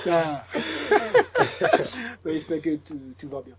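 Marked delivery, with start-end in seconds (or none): 0.5-2.2 laugh. Real, not acted. Host laughs with guest.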